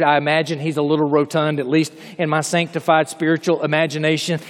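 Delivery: ordinary talk, nothing marked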